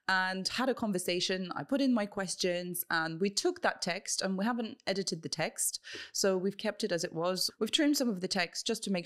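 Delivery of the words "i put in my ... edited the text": ordinary delivery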